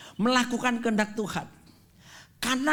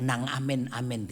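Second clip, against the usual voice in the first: Surabaya Javanese mutter; 7 semitones lower, 5 dB quieter than usual. Retold or imitated. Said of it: "orang Surabaya bilang".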